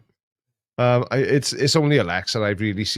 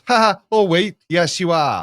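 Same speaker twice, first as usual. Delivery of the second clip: high, loud mock taunt; cheeky answering back; 6 semitones higher, 5 dB louder than usual. Google answering its owner back, cheeky and raised. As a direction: tease like a device talking back. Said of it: "it'll answer me back and go".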